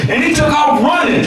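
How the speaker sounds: normal talking voice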